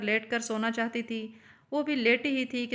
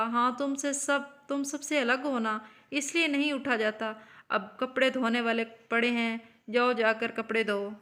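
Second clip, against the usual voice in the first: plain reading voice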